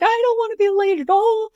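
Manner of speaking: high, loud whiny wail of a daughter; imitated crying child. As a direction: wail like an upset kid, hammed up. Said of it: "she's crying and she's crying".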